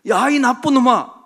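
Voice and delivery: high, very loud name-calling; mock angry shout. Son echoing his bully's words, mock angry shout. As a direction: imitate a kid hurling the insult straight back.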